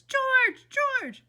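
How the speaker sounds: high, loud screamed name. A narrator's reading. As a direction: scream out for your husband. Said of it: "There was another scream".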